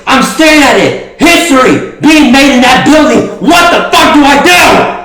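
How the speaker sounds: frantic high shouting promo; wrestling promo. Acted. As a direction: yell it like a desperate wrestler. Wrestling promo, shouting outside arena.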